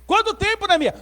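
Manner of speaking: high-pitched, pressing question; incredulous demand. Acted. King pressing Neemias on the time, incredulous. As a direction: press for the answer in disbelief.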